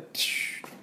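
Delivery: unvoiced take-off whoosh; hissed imitation of a plane shooting off. Shown as a demonstration. Teacher illustrating the plane leaving, whooshing sound effect.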